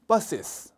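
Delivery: "buses" with both s sounds clearly said; clear plural ending. Shown as a demonstration. Teacher drilling the plural, both s sounds clear. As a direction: say "buses" as two syllables, sounding the s twice. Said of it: "S 발음을 꼭 두 번 해주셔야 됩니다".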